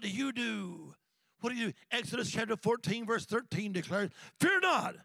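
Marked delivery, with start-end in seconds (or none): 4.4-5.0 loud, high Fear not; shouted phrase. Shown as a demonstration. Preacher proclaiming the verse, loud and high. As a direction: ring it out. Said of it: "I love that phrase again".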